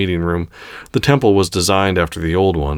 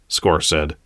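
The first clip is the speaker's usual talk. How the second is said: plain reading voice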